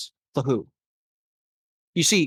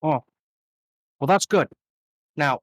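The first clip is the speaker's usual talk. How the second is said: ordinary talk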